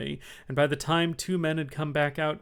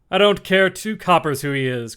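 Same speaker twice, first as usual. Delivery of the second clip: louder, gruff sailor outburst; loud cry; 5 semitones higher, 9 dB louder than usual. A narrator's reading. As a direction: bellow it across the room. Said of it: "cried Silver".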